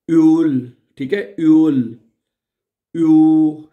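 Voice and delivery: यूल said with rounded lips; German ö. Shown as a demonstration. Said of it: "मुंह को गोल करने की बात है". (होंठ गोल करके "यूल")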